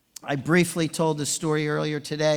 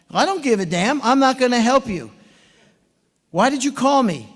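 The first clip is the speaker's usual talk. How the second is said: higher and louder shouted refusal; mock shout; 8 semitones higher, 7 dB louder than usual. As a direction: yell it like an old coach losing patience. Rival coach shouting refusal.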